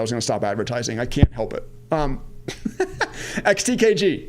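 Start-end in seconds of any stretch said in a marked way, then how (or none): 2.3-3.4 quick airy laugh at himself; a sheepish little laugh, caught out advertising; breathy laugh. Real, not acted. Laughing at himself for plugging his own product again. 3.4-4.3 laughing. Real, not acted. Reads ticker while still laughing.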